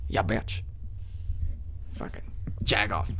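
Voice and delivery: whispered insults under the breath; unvoiced mutter. Guard insulting a departing entrant under his breath. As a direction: hiss it so they can't hear. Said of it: "muttered insults".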